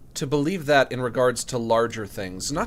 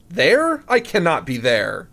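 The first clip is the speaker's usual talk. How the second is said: high, loud questioning yelp; incredulous; 4 semitones higher, 6 dB louder than usual. Real, not acted. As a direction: blurt it out in disbelief. Disbelief at own map position.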